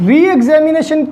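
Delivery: ordinary delivery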